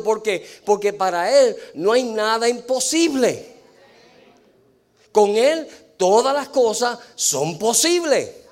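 high, loud preacher's proclamation; full-force, emphatic sermon delivery; rousing preaching. Real, not acted. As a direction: drive the message home to the congregation.

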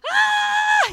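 very high, loud mock shriek; Exorcist-style shriek. Mother hanging up, then shrieking at the kids. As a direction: scream like a possessed mother. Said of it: "The Exorcist style".

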